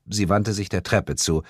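ordinary delivery, nothing marked

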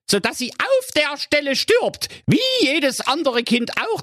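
very high, bossy commanding voice; the evil stepmother commanding. A narrator's reading. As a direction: order the caretaker about like a shrill headmistress. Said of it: "und sprach".

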